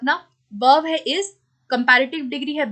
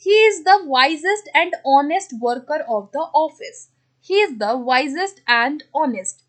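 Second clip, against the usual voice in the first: slow dictation; much slower than usual. Shown as a demonstration. Teacher reads out the exercise sentence.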